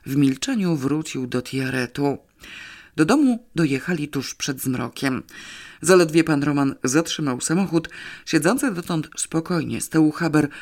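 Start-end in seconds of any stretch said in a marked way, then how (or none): none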